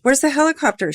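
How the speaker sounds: high, loud urgent question; shouted. A narrator's reading. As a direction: shout it back at Connor. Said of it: "she shouted at Connor".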